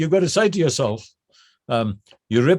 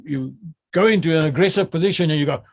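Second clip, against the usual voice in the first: ordinary talk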